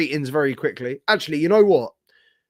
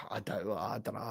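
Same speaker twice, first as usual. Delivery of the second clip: dropped to a whisper after a sigh; barely voiced, half to himself; muttered aside; 7 semitones lower, 17 dB quieter than usual. Real, not acted. Streamer trailing off to himself in a near whisper.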